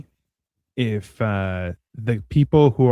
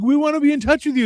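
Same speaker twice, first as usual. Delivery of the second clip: eager earnest plea; 11 semitones higher, faster than usual. Retold or imitated. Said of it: "I'd be like".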